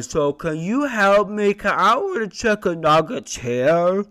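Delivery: high, loud put-on voice under pressure; hammed-up inner voice, pitched high and loud; voice of his consciousness. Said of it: "what my consciousness sounds like".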